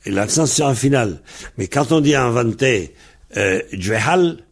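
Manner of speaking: ordinary delivery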